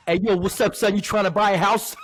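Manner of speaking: playful New York street voice; New York accent. Acted. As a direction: pitch the house sale in a mock New York accent. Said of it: "like, a New York accent".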